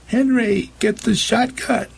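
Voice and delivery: shrill frightened shout upstairs; frantic yell. Acted. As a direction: call for help in terror.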